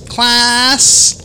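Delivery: teacher calling the room to order in a high voice; Becky voice. Retold or imitated. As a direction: summon the class like a chirpy teacher. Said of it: "her Becky voice".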